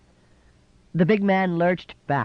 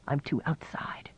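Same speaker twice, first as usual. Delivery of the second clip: a hushed, voiceless whisper; 9 dB quieter than usual. A narrator's reading. Nichol despairing to Jessie, whispering. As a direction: let the breath carry it.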